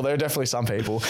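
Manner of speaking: ordinary talk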